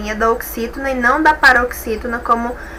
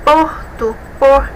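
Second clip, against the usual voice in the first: "Porto", then "por" alone, punched; stress first syllable; slower than usual. Shown as a demonstration. Teacher showing the strong syllable, "Porto" then "por" stressed. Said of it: "a sílaba forte".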